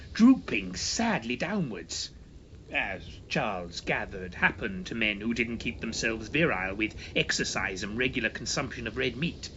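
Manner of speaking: usual speaking voice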